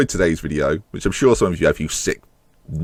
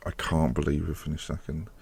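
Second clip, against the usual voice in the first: quiet disbelief; 6 semitones lower, 13 dB quieter than usual. Real, not acted. Disbelief after losing title.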